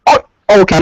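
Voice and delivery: ordinary delivery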